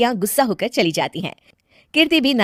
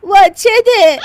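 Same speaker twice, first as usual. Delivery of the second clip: high, loud wailing reproach; tearful indignation; 10 semitones higher, 8 dB louder than usual. Acted. Widow to laughing neighbour, tearful indignation. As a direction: protest in hammed-up grief.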